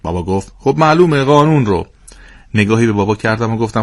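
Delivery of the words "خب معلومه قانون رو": a louder, offhand father; tossed off as obvious; a hint of a smug father; father's voice. Father answers matter-of-factly.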